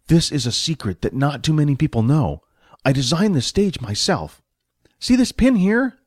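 proud stage manager. Acted. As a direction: show off your invention, pitch climbing high at the end. Manager shows off his design.

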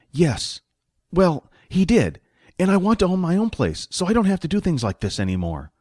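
lightly voiced sidekick explaining himself; money-minded sidekick. Voices Jack in the story.

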